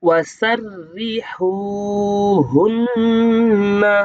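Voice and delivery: slow melodic tajweed chant; Quranic recitation. Shown as a demonstration. Teacher recites the verse slowly for students to copy. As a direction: intone each word long and steady.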